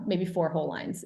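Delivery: usual speaking voice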